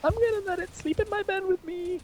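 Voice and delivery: doting, very high falsetto; creepy put-on lady fussing over her pet; creepy woman voice. Woman defending her precious dog, creepy high woman voice. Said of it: "the creepiest woman voice".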